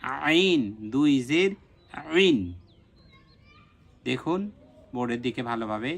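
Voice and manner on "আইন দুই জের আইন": slow আইন with a squeezed pharyngeal onset; deliberate Arabic ayn on each আইন; mid-throat ayn. Shown as a demonstration. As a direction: spell আইন দুই জের আইন, tightening mid-throat. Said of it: "কণ্ঠনালীর মাঝখান থেকে উচ্চারণ হবে".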